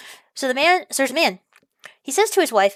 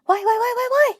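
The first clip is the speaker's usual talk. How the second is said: high whining why; 12 semitones higher than usual. Retold or imitated. Vlogger whines at a negative boomer couple, high pitched. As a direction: wail the whys up high in despair. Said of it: "I'm just like".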